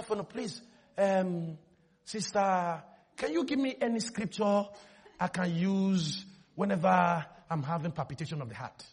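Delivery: low, very soft pleading; meek request. Retold or imitated. Church member asking a sister for a scripture, meek and hushed. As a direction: ask softly and low, as if needing a favour.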